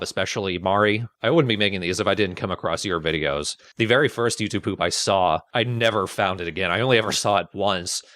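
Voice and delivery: normal talking voice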